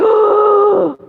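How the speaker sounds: high, drawn-out mock gasp; shocked gasp. Storyteller reacting to a stranger being a realtor, shocked gasp. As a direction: suck in air in shock.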